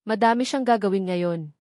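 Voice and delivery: ordinary delivery, nothing marked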